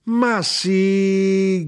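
high, loud, held exclamation; sustained high cry of insistence; insistent shout. A narrator's reading. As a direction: cry out in exasperated confirmation. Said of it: "gridò mia madre".

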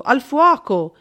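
raising a false fire alarm, loud shout. A narrator's reading. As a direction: yell it to spread panic.

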